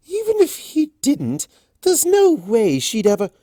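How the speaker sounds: high, loud dismissive scoff; scoffing pony. Acted. Pony scoffing about Fluttershy and Discord, high and loud. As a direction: wave the idea away.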